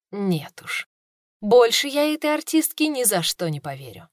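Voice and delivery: usual speaking voice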